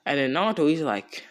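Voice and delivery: ordinary delivery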